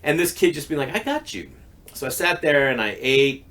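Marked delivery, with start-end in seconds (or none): 1.0-1.5 reassuring. Retold or imitated. Kid reassuring his friend.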